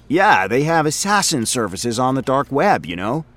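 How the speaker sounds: a rambling, self-amused office guy; chatty coworker voice, in a different timbre from the narrator; Frink, chatty coworker. Acted. Frink voice again.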